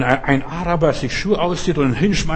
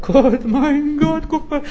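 hammed-up tearful lament, pitched high; mock wailing; 5 semitones higher than usual. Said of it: "plärt und sagt".

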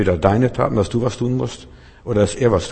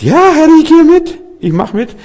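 much higher, louder, mock-eager yes; eager believer; 11 dB louder than usual. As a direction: chirp it like an overeager follower. Mocks an eager believer's reply. (übereifriger Gläubiger, viel höher und lauter)

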